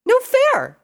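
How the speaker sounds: very high, loud outcry; indignant cry. A narrator's reading. Pinkie backing away from venomous blades, indignant cry. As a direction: cry out in high-pitched protest. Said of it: "she cried out".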